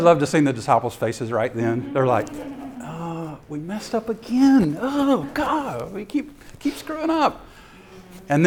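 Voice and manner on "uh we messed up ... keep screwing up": high-pitched mock dismay; sheepish regret. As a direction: whine like scolded disciples who blew it. Disciples realise they messed up.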